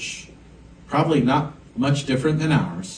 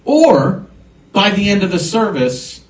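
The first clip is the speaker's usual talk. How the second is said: higher, louder preacher build; full-force pulpit preaching; impassioned preaching; 5 semitones higher, 4 dB louder than usual. Acted. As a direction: lift the congregation with rising fervor. Preaching about sensing burdens.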